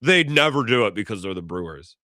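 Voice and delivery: mock smug pundit; chesty national media. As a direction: puff up like a cocky pundit. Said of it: "get all chesty and be like".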